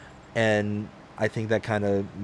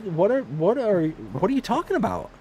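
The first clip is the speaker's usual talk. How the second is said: incredulous protest; 8 semitones higher than usual. Retold or imitated. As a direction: plead your confusion up high. Retelling his own confused protest.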